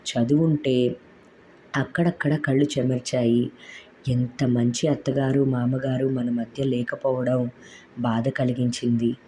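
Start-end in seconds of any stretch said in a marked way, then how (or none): none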